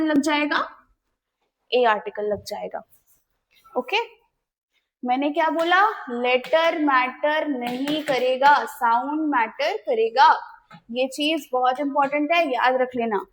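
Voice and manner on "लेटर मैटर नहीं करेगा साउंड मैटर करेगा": slow, word-by-word stress on "लेटर मैटर नहीं करेगा, साउंड मैटर करेगा"; "साउंड मैटर करेगा" drawn out slowly as the key rule; emphatic rule. Real, not acted. Teacher restates key rule.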